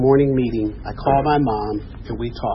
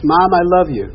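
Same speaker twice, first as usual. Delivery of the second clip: higher, louder "I love you"; retold eager affection; loud declaration; 4 semitones higher, 6 dB louder than usual. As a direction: call it out to a parent. Son's call before mother's softened reply.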